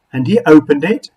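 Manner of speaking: ordinary delivery, nothing marked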